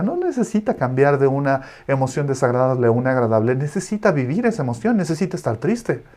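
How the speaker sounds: normal talking voice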